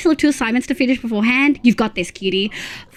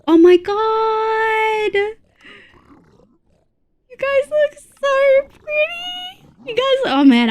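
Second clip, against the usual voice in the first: high, drawn-out adoring squeal; moved to near tears; 11 semitones higher, much slower than usual. Real, not acted. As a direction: gush slowly, right up high, close to crying. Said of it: "that makes me wanna cry".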